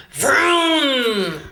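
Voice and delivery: throaty engine roar; engine vroom. Shown as a demonstration. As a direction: rev it like a car engine. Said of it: "Or cars vrooming".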